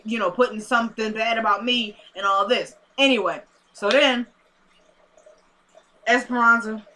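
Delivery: ordinary delivery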